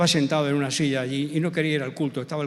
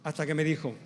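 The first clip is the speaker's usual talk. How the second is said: usual speaking voice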